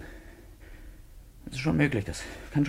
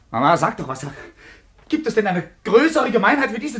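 angry; 9 semitones higher, 4 dB louder than usual. Acted. He appeals to mother in rage.

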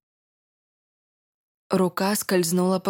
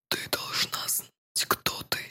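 soft, slow whisper into the ear; 9 dB quieter than usual. Acted. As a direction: breathe the words close to her ear. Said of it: "Горячее дыхание раздалось над ухом".

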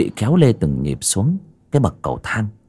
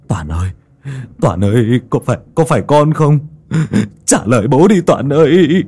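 building from a call to a louder, higher cry; frantic shouting; 4 semitones higher, 4 dB louder than usual. Acted. Father at the painting, shouting to his frightened son. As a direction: scream the name as panic grows. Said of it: "Lão Bạt gào thét".

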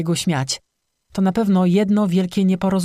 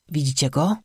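tense question. A narrator's reading. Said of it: "zapytał z napięciem".

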